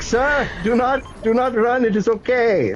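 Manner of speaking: put-on urgent Middle Eastern accent telling him to stop running. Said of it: "I'm going to do an impression of a Middle Eastern gentleman".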